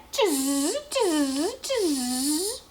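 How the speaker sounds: buzzy, drawn-out monkey noise, rising at the end; mock him like monkeys, in buzzing hisses; monkey chatter. A narrator's reading. Monkeys shake fingers back at the peddler, mocking chatter. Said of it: "the monkeys ... said".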